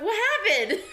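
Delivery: high, loud mock alarm; startled question. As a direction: blurt it out high with a laugh. Acting own reaction to sobbing child.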